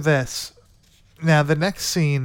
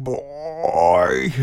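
put-on long drawn-out "Boy"; imitating the Tall Man. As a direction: stretch the one word out in a mock menacing call. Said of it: "you hear him say, like, "Boy".

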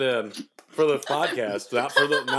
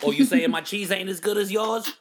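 mock-tough New Yorker, raised pitch; New York accent; 7 semitones higher than usual. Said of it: "All Wisconsinites sound like New Yorkers".

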